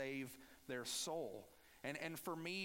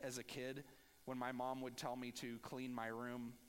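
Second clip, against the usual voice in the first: ordinary delivery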